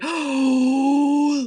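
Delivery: loud high gasp of mock shock; dramatic gasp. Imagining the cheating reveal, a sharp gasp. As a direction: gasp as if you just caught them. Dramatic twist in her story.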